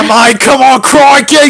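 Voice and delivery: loud, high mock Australian; bad Australian. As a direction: exclaim in a hammed-up Aussie accent. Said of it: "I just went Australian there".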